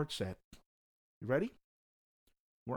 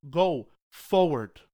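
slow loud command; 7 dB louder, much slower than usual. Acted. Preaching climax.

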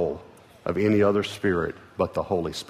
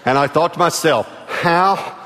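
loud, high, driving preacher voice; preaching at full force; 7 semitones higher, 5 dB louder than usual. Acted. Pastor warning his church about spirits, preaching at full force. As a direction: push it out to the whole congregation.

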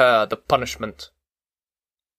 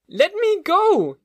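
put-on very high, slow shrieking child's voice; a small boy wailing in fright; terrified child crying out; slower than usual. A narrator's reading. Child struggling against the monster, crying out in high panic. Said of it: "he cried".

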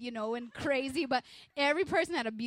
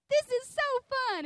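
very high, loud and slow sing-song joy; giddy delight; 9 dB louder, much slower than usual. Retold or imitated. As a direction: sing it out like a happy skipping kid.

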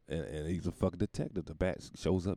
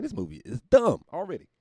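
fed-up; 10 semitones higher, 6 dB louder than usual. Retold or imitated. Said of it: "I'm like".

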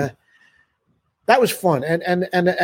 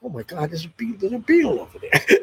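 mock starstruck; 4 semitones higher than usual. Musician spots a Beatle mid-song, acted amazement. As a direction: act out dawning awe, starting under the breath.

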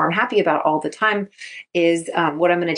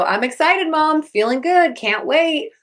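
high, bright-pitched mock eagerness; excited reply; 9 semitones higher than usual. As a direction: sound pumped even if faking it.

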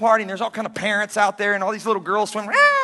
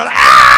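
low, loud startled shout; panicked yell; 11 dB louder than usual. Retold or imitated. Pastor reenacts his yell while jumping in to save the girl. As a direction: blurt a panicked yell as you leap.

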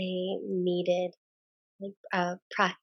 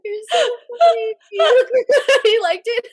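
loud, high laughter breaking the words; can barely get the words out for laughing; laughing hard; 13 dB louder than usual. Real, not acted. Bursting laughter after the exercise.

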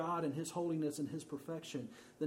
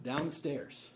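usual speaking voice

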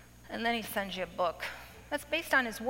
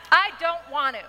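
put-on louder, laughing childish whine; whiny refusal; 4 semitones higher, 7 dB louder than usual. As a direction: stamp your foot like a sulking child, half laughing. Pouting refusal repeated louder.

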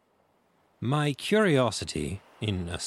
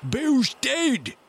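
high, loud cry of news; shouting from inside; 5 dB louder than usual. A narrator's reading. Man in the house to the gang, high shout. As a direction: yell it out in alarm to those outside. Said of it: "a voice shouting from the house".

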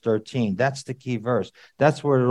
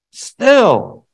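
high, loud burst on one word; emphatic yell; 10 semitones higher, 8 dB louder than usual. Shown as a demonstration. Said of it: "I won't yell at you anymore".

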